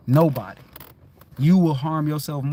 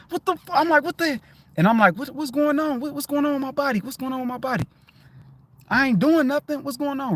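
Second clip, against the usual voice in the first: high, alarmed self-questioning; panicked; 10 semitones higher than usual. Retold or imitated. To himself, confused by sudden knee pain, high alarmed. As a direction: voice jumps up in panic.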